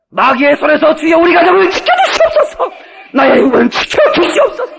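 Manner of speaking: high, very loud shouted plea to God; crying out in prayer. Real, not acted. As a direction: cry out to the Lord at full force. Preacher cries out in prayer.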